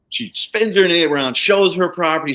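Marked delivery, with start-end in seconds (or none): none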